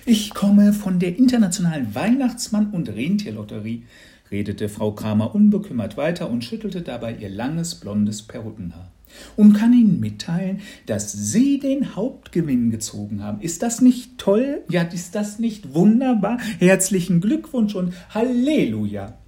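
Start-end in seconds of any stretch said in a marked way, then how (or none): 0.1-3.8 high, loud, over-the-top saleswoman voice; a disguised neighbour laying on the cheer; hammed-up gushing congratulations; gushing lottery lady. Disguised neighbour announces herself. 9.3-19.2 high, loud, over-the-top saleswoman voice; a disguised neighbour laying on the cheer; hammed-up gushing congratulations; gushing lottery lady. Announcing the prize.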